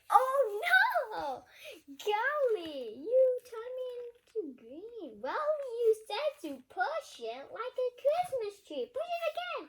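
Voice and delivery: high, loud comic outcry; loud dismay. Acted. Larry the monster crying out at being turned green. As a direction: cry out in cartoon shock.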